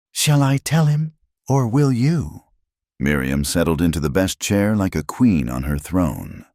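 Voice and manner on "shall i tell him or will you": taunting. A narrator's reading. Miriam taunting.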